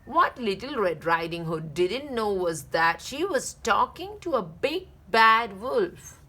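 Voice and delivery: ordinary delivery, nothing marked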